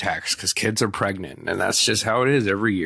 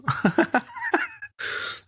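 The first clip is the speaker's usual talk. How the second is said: high squeal laugh; 5 dB quieter than usual. Real, not acted. Squealing laugh after joke.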